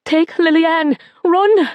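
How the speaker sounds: high, loud frantic order; panicked shout. A narrator's reading. Mother orders daughter to flee, frantic high shout. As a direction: scream at her to run.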